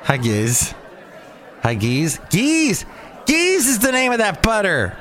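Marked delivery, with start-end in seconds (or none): 2.3-4.9 excited. Real, not acted. Excitedly recalls butter name.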